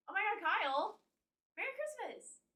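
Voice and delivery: high-pitched excited squeal of welcome; excited greeting. Real, not acted. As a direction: greet a friend with high, delighted surprise. Greets Kyle on seeing him.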